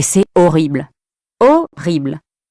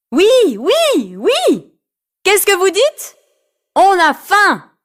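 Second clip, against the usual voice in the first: put-on high children's chorus voice; kids answering; 11 semitones higher, slower than usual. Party kids answer Karine, high-voiced and eager. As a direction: shout back like excited kids.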